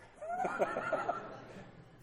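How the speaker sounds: a bright burst of real laughter. Real, not acted. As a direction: laugh at yourself, unforced. Laughing at own long amends.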